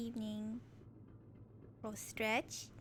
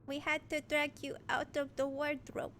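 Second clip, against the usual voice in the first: clumsy attempt at a Russian accent; Russian tough guy, amateur accent; bad Russian accent. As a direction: put on a shaky-skilled Russian accent. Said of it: "Should I attempt a Russian accent?".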